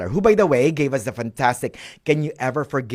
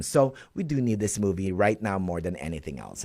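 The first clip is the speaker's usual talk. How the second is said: normal talking voice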